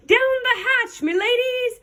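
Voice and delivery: high-pitched dinner-table holler; pirate yell. A narrator's reading. Captain starts dinner with his crew, yelling. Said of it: "pounded his fist on the table and yelled".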